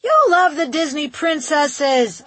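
high, loud contradiction; shouting. A narrator's reading. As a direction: shout it at a child in the next room. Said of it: "I shouted".

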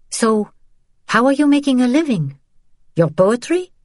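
high, pointed challenge; challenging. Acted. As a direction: needle him with a question. Daughter challenges father, high.

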